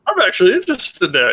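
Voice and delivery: ordinary talk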